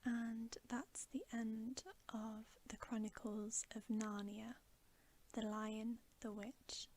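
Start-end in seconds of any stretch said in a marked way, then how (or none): none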